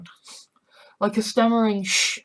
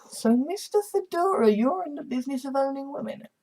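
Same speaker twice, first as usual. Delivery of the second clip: mocking high voice; 6 semitones higher than usual. Sally mocking the Farshnik.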